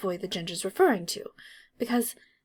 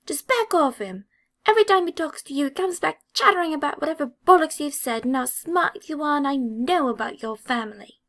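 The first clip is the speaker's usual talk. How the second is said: loud, higher, fast angry rant; angry sputtering; 6 semitones higher, 5 dB louder than usual. A narrator's reading. Angry redhead sputters at Draco about his friend, fast and furious. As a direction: sputter it out in a rush. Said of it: "the angry Ginger sputters".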